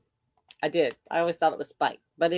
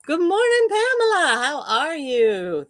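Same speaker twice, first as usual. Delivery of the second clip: greeting pitched way up, bright and lilting; high sing-song greeting; 9 semitones higher, 3 dB louder than usual. Real, not acted. Host greeting a viewer in a high sing-song. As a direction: lift the voice high to greet her.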